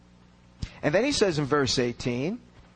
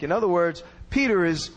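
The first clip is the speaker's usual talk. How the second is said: ordinary talk, nothing marked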